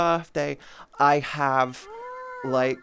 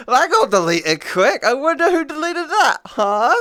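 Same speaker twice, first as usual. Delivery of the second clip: high, loud, knowing tease; teasing; 8 semitones higher, 8 dB louder than usual. Acted. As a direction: poke fun at whoever deleted it. Teasing mod deletion.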